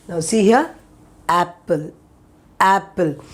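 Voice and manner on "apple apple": apple with an open a as in cat; apple drill. Shown as a demonstration. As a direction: open the mouth wide for apple. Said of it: "when you pronounce this letter".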